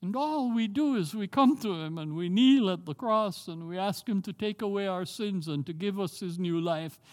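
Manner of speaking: usual speaking voice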